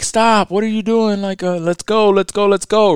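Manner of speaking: put-on high whiny girlfriend voice; whiny ex impression. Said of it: "My ex is like".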